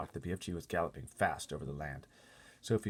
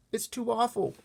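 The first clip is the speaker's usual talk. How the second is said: put-on high, loud, shocked girl; horrified little girl; 9 dB louder than usual. Sophie hearing how giants eat people, high horrified girl. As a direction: cry out in horror like a small girl.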